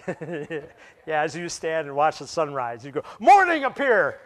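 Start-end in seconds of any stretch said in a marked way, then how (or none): 3.2-4.2 hammed-up shout, high and booming; mock command. Sunrise watcher pretending to command the morning, mock grand shout. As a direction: boom it out as if the sky obeys you.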